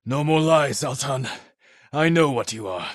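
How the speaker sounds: fierce accusation; angry confrontation. Acted. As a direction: call him out to his face. Confronting the warlord Altan.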